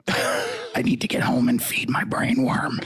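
slow, whispery strained rasp. Acted. Senator excusing himself from questions, slow strangled R F K voice. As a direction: drag the words out through a tight throat. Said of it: "your R F K impression".

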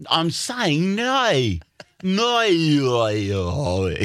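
high, slow, drawn-out Australian "no"; Australian accent. Acted. Australian insisting on being understood, exaggerated Australian accent. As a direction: drag "no" through every Australian vowel. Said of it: "Australian people".

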